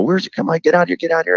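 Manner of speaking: high urgent call to teammates; frantic calling out. Retold or imitated. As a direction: hurry them onto the field.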